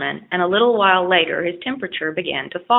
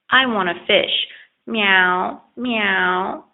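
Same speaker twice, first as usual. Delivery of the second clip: cat voice; 2 semitones higher, much slower than usual. A narrator's reading. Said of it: "she purred again".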